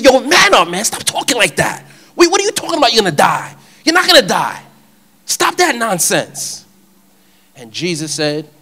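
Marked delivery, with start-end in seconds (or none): 0.0-6.6 loud, high-pitched rebuke; Peter. Acted. Peter takes Jesus aside to rebuke him, loud and scolding. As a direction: scold him hard, incredulous and forceful. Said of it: "Peter rebuked Jesus".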